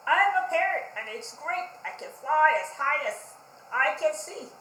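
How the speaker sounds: loud, proud bragging; boastful Pig. Acted. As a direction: brag about your jumps to the parrot.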